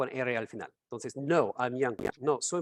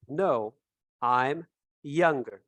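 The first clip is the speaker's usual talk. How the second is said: "No, I'm younger" slowly, word by word; slow teacher model with a pause after "No"; slow model answer; much slower than usual. Shown as a demonstration. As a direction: space out "No, I'm younger" for learners.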